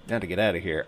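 ordinary delivery

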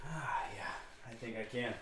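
soft whisper. Real, not acted. Quiet confident answer.

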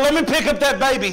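high, quick, insistent protest; arguing papa. Acted. Papa begging to pick up the baby, arguing.

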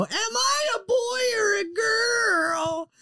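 high, slow shrieking question; hammed-up high yell at a party; mock shriek. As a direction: squeal it like a party bit. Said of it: "doing the mangina, and I go".

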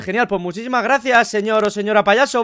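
usual speaking voice

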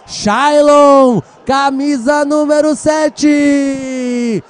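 long held goal scream; explosive goal commentary. Real, not acted. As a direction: belt out the goal call.

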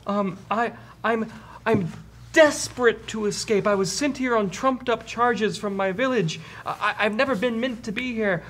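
high, hesitant frail runt voice; Snoo Snoo. Acted. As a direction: explain desperately in falsetto, stumbling over words. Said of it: "a pretty frail looking orc".

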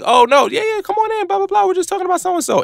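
eager welcome. Retold or imitated. A group welcomes the person who joined, eagerly. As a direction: gush as you wave a newcomer in. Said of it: "It was like".

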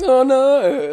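in character. Acted. Drink knocked from his hand, in camp character. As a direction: play the girly dismay big. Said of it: "I'm in character".

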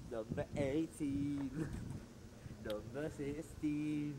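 slow, stretched number calling; drawn-out announcing. Pretend. Walker reads door numbers aloud to mate, slow and stretched. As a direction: call each door number out slow and grand.

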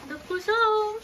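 high cheery welcome home; welcoming someone home. Real, not acted. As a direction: greet them warmly as they arrive. Greeting dad arriving home.

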